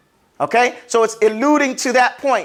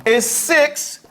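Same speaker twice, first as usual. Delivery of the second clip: ordinary delivery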